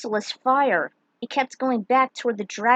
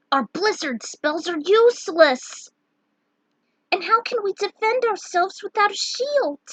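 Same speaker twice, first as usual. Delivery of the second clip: panicked outcry; 9 semitones higher, 3 dB louder than usual. Acted. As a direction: cry out in despair that nothing works. Lunith panics, spells useless.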